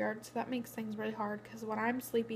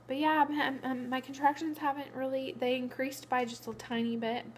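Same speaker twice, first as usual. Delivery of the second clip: usual speaking voice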